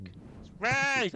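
high mocking imitation of Jack. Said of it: "since when do I sound like that".

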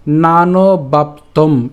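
"নানবাপ্তম" very slow, stopping on a vowelless m; closed m ending. Shown as a demonstration. Teacher pronouncing up to the hasanta, slow demo. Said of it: "ম হসন্ত পর্যন্ত আমরা উচ্চারণ করলাম".

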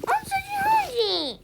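very high squealed play voice; squeaky back-talk. Pretend. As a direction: argue back in a tiny squeak. Play figure argues back, squealing.

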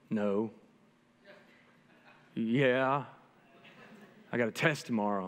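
put-on low, mumbled sulky teen reply; lightly voiced sulky fourteen-year-old; whiny teen. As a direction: play the tired son grunting answers. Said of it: "and he's whiny".

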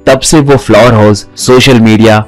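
plain reading voice

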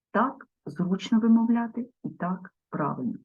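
ordinary talk, nothing marked

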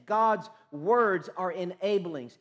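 slow, weighty, stressed; slow emphasis. Acted. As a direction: lean on every word. Sermon key line.

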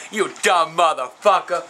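slow, fed-up cussing; getting mad. Real, not acted. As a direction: drop the pitch and spell out the insult. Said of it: "I'm getting mad".

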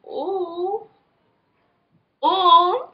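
high impressed ooh; admiring coo. Real, not acted. Admiring makeup.

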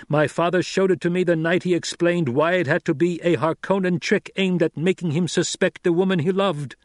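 high, loud, urgent explanation; forceful young lord. Acted. Son clearing his mother of suspicion, forceful. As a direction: drive the explanation home. Narrator voices Paul explaining.

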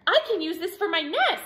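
high, quick chatter; chirping bird. A narrator's reading. Swoopy bird claiming the hat for a nest, quick high chirp. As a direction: chirp it briskly. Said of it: "chirped a swoopy bird".

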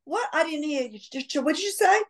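very high, sweet-mom put-on voice; sixties mom. Mom answering her children while stuck in her role, sixties mom voice. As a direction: play the mother in character, pitched far up. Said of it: "the perfect little sixties mom".

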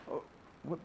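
breathless whispered gasp of disbelief; stunned believer hearing God's no, whispered; shocked whisper. Retold or imitated. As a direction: choke out the shock under your breath.

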